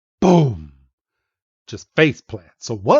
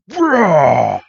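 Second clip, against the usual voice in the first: impact exclamation; 4 semitones higher, 6 dB louder than usual. Acted. Punch hits the ground.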